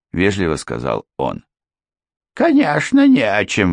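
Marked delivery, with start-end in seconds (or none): 2.3-3.7 high sharp exclamation; exclaiming. A narrator's reading. Housekeeper exclaiming to the lawyer, pitched high. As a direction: snap back in agreement. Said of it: "воскликнула госпожа Крент".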